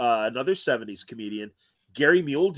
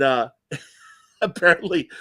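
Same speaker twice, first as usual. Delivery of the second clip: ordinary talk, nothing marked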